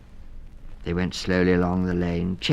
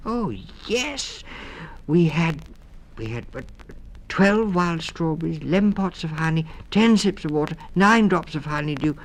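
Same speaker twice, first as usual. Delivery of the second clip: in a hurry; 11 semitones higher, 4 dB louder than usual. Acted. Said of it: "said Snug in a hurry".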